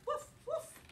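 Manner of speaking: high, bouncy woof woof; playful dog bark. Acted. Teacher barking for Biscuit to the kids, high and playful. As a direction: bark like a happy puppy.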